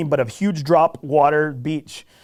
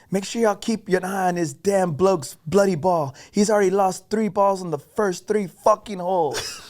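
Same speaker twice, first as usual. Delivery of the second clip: slower, a hint of an Australian accent; 4 semitones higher than usual. A tall Australian teasing the group, light Australian accent. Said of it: "he's from Australia".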